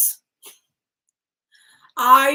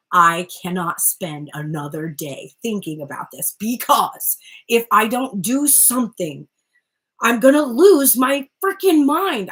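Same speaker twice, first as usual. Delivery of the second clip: exasperated; 6 dB louder than usual. Acted. Voicing the masculine who can't stop thinking, exasperated. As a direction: act fed up, erupting on the last line. Voices masculine at breaking point.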